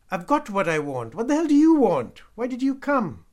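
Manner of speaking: loud, high-pitched challenge; furious shout. A narrator's reading. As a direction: yell accusing questions at full force. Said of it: "he shouted".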